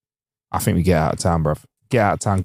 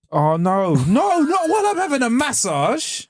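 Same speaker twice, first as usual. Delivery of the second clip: high, slow whining plea; mock pleading whine; 13 semitones higher, slower than usual. Friend pleading not to be chatted to mid-massage, high whine.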